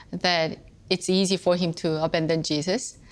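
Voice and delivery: ordinary talk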